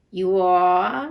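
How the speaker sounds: loud, rising mock-stern question; repeating as Scrooge. A narrator's reading. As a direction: throw it back loudly as a challenge. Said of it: "repeated Scrooge".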